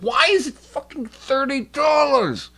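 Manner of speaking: very high, slow, stretched disbelief; incredulous outrage. Real, not acted. Shocked at the cable price, high stretched outrage. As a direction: drag it out high, stunned by the price.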